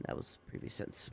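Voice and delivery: fast, breathy under-the-breath aside; quick whispered aside. Real, not acted. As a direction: mutter it to yourself, fast. Aside after misreading.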